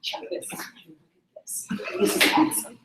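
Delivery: whispered, understated enthusiasm; quiet excitement. Shown as a demonstration. Trainer shows quiet sellers how to sound excited, whispered. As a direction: show excitement at barely audible volume. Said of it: "For those of you who are more quiet".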